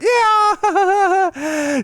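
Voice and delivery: very high, loud, drawn-out yeah; high squealed yeah. Retold or imitated. Stripper sweetly agreeing, high loud squeal.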